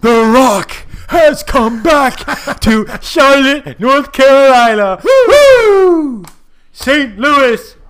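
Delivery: slow, high, loud promo bellow; arena hype yell ending in a woo; wrestling promo. Pretend.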